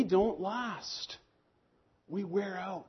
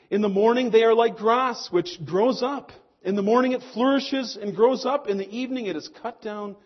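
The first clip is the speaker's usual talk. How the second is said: louder, lifted psalm reading; proclaiming scripture; 4 semitones higher, 8 dB louder than usual. Acted. Preacher reads Psalm ninety to the church, proclaiming scripture. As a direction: read it out as a proclamation.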